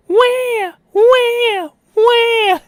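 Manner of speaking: very high, loud fake baby wail; three hammed-up bawls; Orion crying impression. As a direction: wail in mock tears, shrieking high. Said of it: "here's my best Orion impression".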